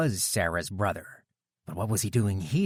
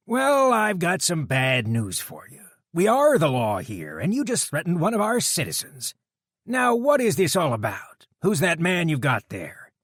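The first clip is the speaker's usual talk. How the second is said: biker deputy voice; 8 semitones higher, 6 dB louder than usual. A narrator's reading. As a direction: coolly call out the guard's threat. Dutch voiced.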